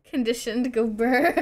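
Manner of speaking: breaking into high laughter; giggly, ending in a big laugh. Real, not acted. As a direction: say it through giggles. Cracking up over the game noises.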